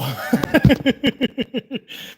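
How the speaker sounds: loud burst of laughter; loud amused cackle. Real, not acted. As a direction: laugh hard at the joke. Laughing at own bit.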